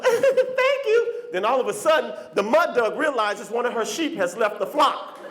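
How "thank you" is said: high giggly thanks; giggly drunk girl. Retold or imitated. Flattered drunk girl thanking a man, high giggle. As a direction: simper it.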